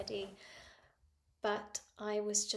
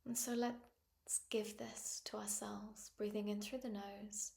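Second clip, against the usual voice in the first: calm phrases with long pauses; guided meditation. Acted. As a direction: invite them gently to notice the breath. Leads a breathing meditation.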